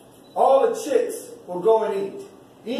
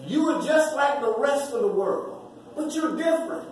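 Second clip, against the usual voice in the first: ordinary delivery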